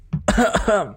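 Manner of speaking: deliberate put-on cough; fake cough. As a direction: cough on purpose. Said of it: "That was a fake cough".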